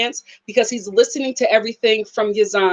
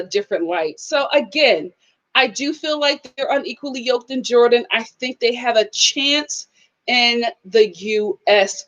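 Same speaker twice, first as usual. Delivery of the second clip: usual speaking voice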